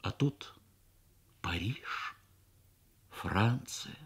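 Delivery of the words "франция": ordinary delivery, nothing marked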